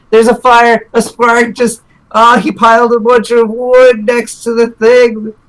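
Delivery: mock panic about the fire; panicked. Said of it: "run up there panicked and just be like".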